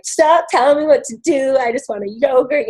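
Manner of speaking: imitating herself bawling at her husband; mock tearful outburst. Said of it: "And I just started bawling".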